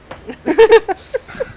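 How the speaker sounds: high cartoon mouse giggle; squeaky falsetto laugh; Mickey Mouse laugh. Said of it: "Mickey Mouse goes".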